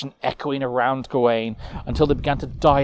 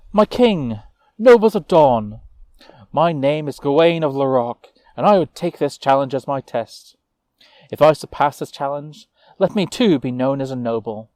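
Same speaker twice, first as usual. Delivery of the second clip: put-on young knight Gawain; 3 semitones higher, 7 dB louder than usual. Gawain addressing the king, lightly voiced earnest plea. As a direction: step forward and claim the challenge proudly.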